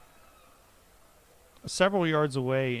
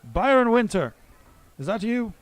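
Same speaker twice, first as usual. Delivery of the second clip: loud call across the street, higher and louder; yelling out; 8 semitones higher, 7 dB louder than usual. Acted. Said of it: "then he yells out".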